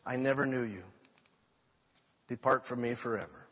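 low, soft and final; grave rejection. Retold or imitated. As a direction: pronounce it quietly and solemnly. Christ rejecting the lost.

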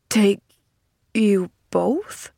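halting, dazed echo. A narrator's reading. Man repeating her plan, dazedly. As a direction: repeat it slowly in disbelief, with pauses. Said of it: "repeated the man dazedly".

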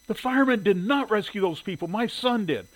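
high, loud indignant protest; angry tirade. A narrator's reading. Shirley correcting the editor about her son, angry tirade. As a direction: launch into an indignant complaint. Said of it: "immediately launched into a tirade".

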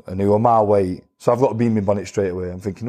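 usual speaking voice

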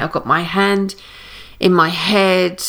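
usual speaking voice

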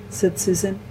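usual speaking voice

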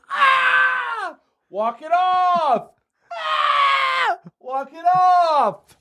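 very high shrieks, slow strained words; agonized screaming. Pretend. Quarterback with an exploded knee telling himself to walk it off, shrieking. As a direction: howl in pain and grind out the words.

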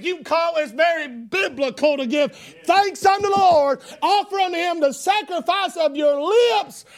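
loud, high preacher's shout; preaching at full force. Acted. Preacher urging the church to praise aloud, shouting. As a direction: preach it hard and loud to the congregation.

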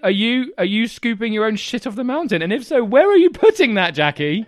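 loud, high, stammering disbelief; comic outraged interrogation; mock outrage. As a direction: fire shouted questions at the absent reviewer. Interrogating the absent reviewer.

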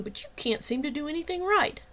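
high, heated telling-off; outraged. A narrator's reading. Jenny berating Wagner for attacking Joe, outraged. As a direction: tear into him with pitch jumping up.

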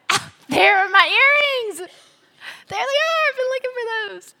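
high, loud, sing-song delight at finding lost earrings; mock delighted discovery. Wife to husband, playfully delighted over her lost earrings.

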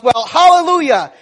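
high, loud last-second cry at the cliff edge; desperate yell. Retold or imitated. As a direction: scream it as the horse nears the drop. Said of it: "and he yells".

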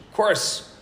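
loud, high imitated reply; emphatic. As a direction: answer instantly as if it were obvious.

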